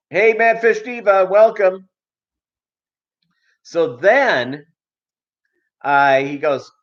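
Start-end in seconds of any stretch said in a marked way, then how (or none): 0.1-1.9 high, bright shout-out; cheery welcome. Real, not acted. Streamer greeting a viewer, cheerful and high.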